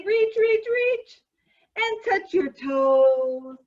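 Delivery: loud, slow stretch cue. Acted. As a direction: call the stretch out slowly. Exercise leader, echo fading.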